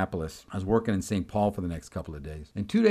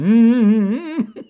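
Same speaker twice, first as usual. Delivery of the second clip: loud, high congregational mm-hmm; imitated church hum of approval; churchy affirming hum; 11 semitones higher, 11 dB louder than usual. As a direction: hum loudly as if answering a preacher. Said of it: "she's going to church".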